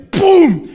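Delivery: loud, explosive "boom"; high, sudden mock bang; imitated thunderclap. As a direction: burst it out like lightning striking. Imitates the lightning strike.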